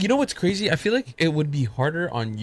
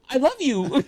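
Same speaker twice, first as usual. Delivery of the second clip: high imitation of a small boy; little boy pleading; 9 semitones higher than usual. Boy to his uncle, sweet mock child voice.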